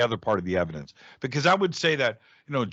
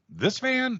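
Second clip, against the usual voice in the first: much higher, startled question; can't believe it's this van; incredulous. Retold or imitated. Asking his friend in disbelief about the van, high surprised.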